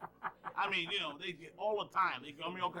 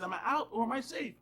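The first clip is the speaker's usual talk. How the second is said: higher-pitched insistent question; retold player's yell; 6 semitones higher, 3 dB louder than usual. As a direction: imitate a player yelling the question. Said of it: "one of the players yelling".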